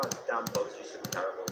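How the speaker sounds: ordinary delivery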